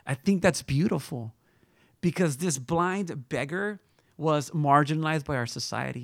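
usual speaking voice

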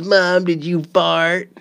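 mimicked kid asking. Son turns the question on mom, drawn-out kid voice. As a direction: stretch it out like a pestering child. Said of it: "And then he goes".

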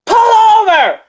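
high, loud shouted command; officer yelling pull over. Retold or imitated. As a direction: bark it at him like a cop over a siren. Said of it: "she was yelling before he even pulled over".

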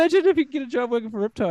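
squeaky, rushed daydreaming voice; mock eager dreamer. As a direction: gush like a naive young grommet. Mimicking his younger eager self.

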